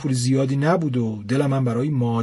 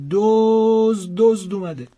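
higher, louder long drawn-out alarm cry; shouting thief; 8 semitones higher, 5 dB louder, much slower than usual. A narrator's reading. Housewife to her husband, loud drawn-out cry of thief. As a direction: raise the alarm on a burglar. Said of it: "داد کشید".

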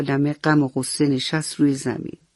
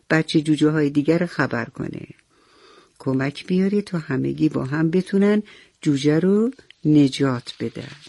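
ordinary delivery, nothing marked

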